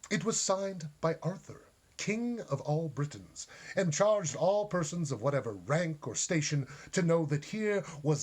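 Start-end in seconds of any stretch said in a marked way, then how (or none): none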